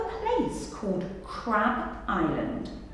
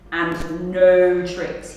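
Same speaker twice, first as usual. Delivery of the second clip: warning; 3 semitones lower, 7 dB louder, slower than usual. Acted. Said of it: "he warned".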